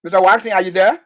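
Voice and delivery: loud call to a missing caller. Real, not acted. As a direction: call out to see who is there. Calling a dropped caller.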